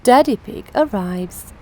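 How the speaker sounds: ordinary delivery, nothing marked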